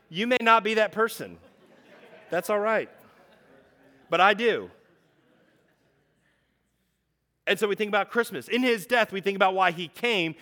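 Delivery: loud, high, driving pulpit voice; preaching at full force. Acted. Pastor driving home the gospel to his congregation, preaching at full force. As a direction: preach it out hard to the room.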